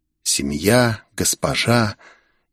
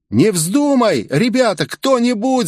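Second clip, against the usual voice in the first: much higher, crying out in alarm; desperate shout; 12 semitones higher than usual. Acted. Sakamoto cries out to save Asami, desperate. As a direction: yell for someone to stop it. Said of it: "закричал Сакамото".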